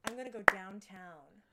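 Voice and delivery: second go at an American accent; Aussie having a proper try at sounding American. Shown as a demonstration. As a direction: put on an American accent, firmly. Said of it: "No, I can't say that".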